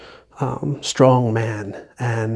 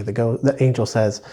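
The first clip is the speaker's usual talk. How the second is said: normal talking voice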